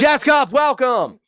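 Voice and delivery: high, loud welcome; excited greeting. Real, not acted. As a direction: call out a big cheerful hello. Streamer greets a chatter loudly.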